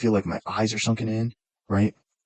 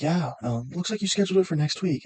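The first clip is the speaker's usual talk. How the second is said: higher, lightly voiced receptionist; 7 semitones higher than usual. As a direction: mimic the receptionist breaking bad news. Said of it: "And she's like".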